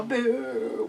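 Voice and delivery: ordinary talk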